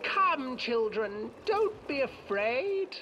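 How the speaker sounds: put-on high, slow old witch voice; sing-song, drawn out like a crone. Witch coaxes the children.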